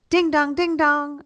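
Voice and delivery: sung high ding dong chime; imitated doorbell. Doorbell rings again.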